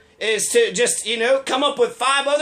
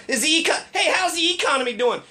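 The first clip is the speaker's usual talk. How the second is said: higher, breezy "hey, how's the economy doing?"; casual everyday version; 6 semitones higher than usual. Shown as a demonstration. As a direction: toss it off like a friendly greeting. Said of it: "I don't say".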